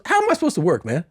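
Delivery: very high-pitched comic complaint; mock outrage, squeaky and aggrieved; retold indignant protest. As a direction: plead your case, voice way up. Fired comic protesting the clean rule.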